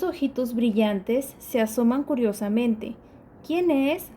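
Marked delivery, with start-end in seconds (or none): 3.5-4.1 grita. Acted. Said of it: "grita ratón".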